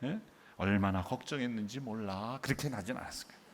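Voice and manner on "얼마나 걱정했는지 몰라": low, mock-composed parent; 점잖은 말투. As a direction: voice the worry with exaggerated restraint. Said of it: "이게 점잖게". (낮은 목소리로 점잖은 척)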